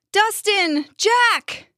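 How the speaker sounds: high-pitched, stretched call. A narrator's reading. Max and Danny calling for their friends, urgent call. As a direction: call the names high, as if far away. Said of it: "they called out in unison".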